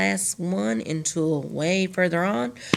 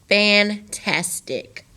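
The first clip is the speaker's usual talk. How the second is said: slow, syllable by syllable; broken into syllables; 3 semitones higher, much slower than usual. Shown as a demonstration. Teacher to class, chopping fantastic into slow syllables. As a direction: stretch fantastic out into its three parts. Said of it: "see how I broke that up?".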